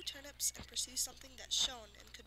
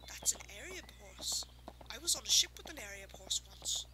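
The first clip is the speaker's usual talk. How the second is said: put-on old man voice; 2 semitones lower, 5 dB louder than usual. A narrator's reading. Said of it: "said quietly to his wife".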